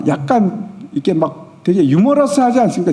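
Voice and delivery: normal talking voice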